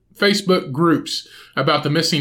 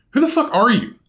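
incredulous outburst; 9 semitones higher than usual. Real, not acted. Ranting at the rooster owner, high incredulous outburst. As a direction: pitch jumps high in disbelief.

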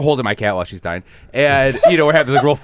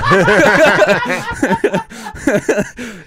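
hearty laugh; 11 semitones higher, 6 dB louder than usual. Real, not acted. Laughing at own joke.

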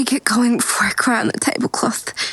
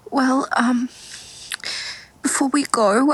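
usual speaking voice

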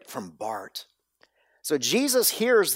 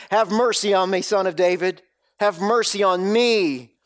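louder, higher cry for mercy; beggar's cry; 5 semitones higher, 4 dB louder than usual. Acted. Preacher voicing the blind beggar crying to Jesus, raised cry. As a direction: call out like a desperate blind man. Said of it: "he was crying out all the more".

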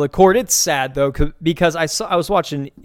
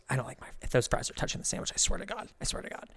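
hushed grumbling; 4 semitones higher, 13 dB quieter, faster than usual. Retold or imitated. Annoyed diner complaining to her husband, hushed grumbling. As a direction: whisper a hurried threat under the breath.

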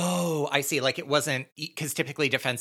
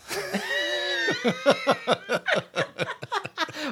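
long, high-pitched peals of laughter; big laugh. Real, not acted. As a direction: crack up completely at a joke. Cracking up at the tennis joke.

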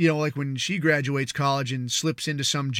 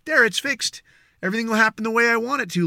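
much higher, fast, self-satisfied; hammed-up know-it-all; mock smug big shot; 6 semitones higher, faster than usual. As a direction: rattle it off like an entitled elite who thinks snapping fingers fixes things. Mocking elites who snap fingers.

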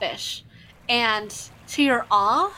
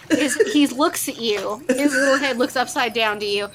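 normal talking voice

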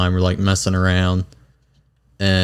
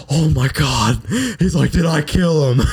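higher, rising panicked voice of the friend; mock panic; 7 semitones higher than usual. Retelling the friend's panic after the chokehold, mock panic. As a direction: play the friend's nervous shock.

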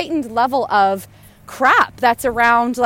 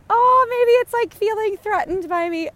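higher, gentle sing-song to a wary animal; coaxing the goose; 10 semitones higher than usual. Real, not acted. To a goose running at her, high coaxing tone. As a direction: soothe a nervous goose in a high sweet voice.

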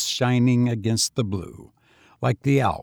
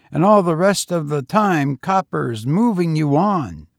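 higher, youthful lad's voice; street boy; 6 semitones higher, 4 dB louder than usual. A narrator's reading. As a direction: play the lonely young tramp, higher. Boy's voice.

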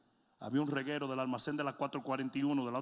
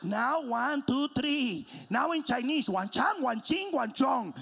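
high, chirpy counting toy; multilingual toy; 9 semitones higher, 6 dB louder, much slower than usual. Retold or imitated. A toy counts in English and Chinese, high toy voice. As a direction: count brightly like a talking toy, pitched up.

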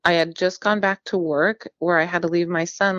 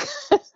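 quick high laugh; short laugh. Real, not acted. Woman laughs while telling the caller to wait, quick and high.

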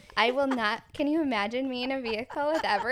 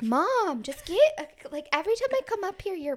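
put-on louder, higher whine of an annoyed teen son; exasperated teen; 10 semitones higher, 7 dB louder than usual. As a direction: complain like a fed-up teenager. Teen son complaining to mom.

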